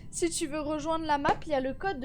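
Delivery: usual speaking voice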